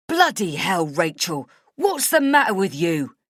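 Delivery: loud angry bellow. A narrator's reading. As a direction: roar it at her. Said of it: "he bellows".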